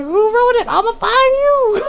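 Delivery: hammed-up outraged cry; mock threatening shout. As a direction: yell it like a playful manhunt. Mock threat to unknown writer.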